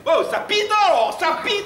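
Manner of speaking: squeaky high waitress voice, loud; high mocking waitress. Barmaid mocks the would-be seducer in a high loud voice. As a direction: play the barmaid teasing him, high and shrill-loud.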